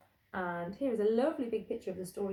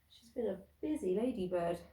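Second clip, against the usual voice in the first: ordinary delivery, nothing marked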